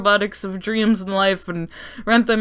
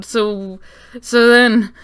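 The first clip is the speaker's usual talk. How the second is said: normal talking voice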